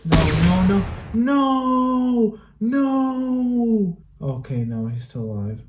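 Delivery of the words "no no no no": high, loud desperate no's; anguished denial. Real, not acted. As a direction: cry out in despair. Climax of battle scene.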